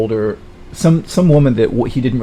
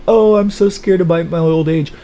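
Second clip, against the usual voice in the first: high, louder mocking wail; mock crying; 7 semitones higher, 5 dB louder than usual. Sally throwing the guy's crying back at him, mocking wail. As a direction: mimic a scared crybaby, pitched up. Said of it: "You were crying".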